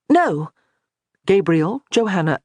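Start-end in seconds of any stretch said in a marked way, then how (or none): 0.1-0.5 sharp loud higher no; all three cut Nicholas off, shouting no; shouted denial. A narrator's reading. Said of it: "all shouted the denial together".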